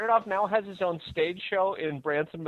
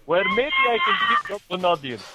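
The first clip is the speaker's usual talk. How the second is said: high, hammed-up Transylvanian Dracula; vaguely Bulgarian Transylvanian count; Transylvanian vampire accent; 5 semitones higher than usual. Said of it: "Brad requested it in Transylvanian, uh, vampire".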